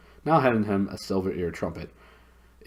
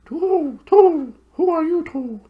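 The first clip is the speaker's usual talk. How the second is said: very high hooting owl voice, far from the narrator's own; high, hooting bird voice; soft hooting owl; 10 dB louder, slower than usual. Acted. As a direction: hoot it like a big owl. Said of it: "said in a soft hooting kind of voice".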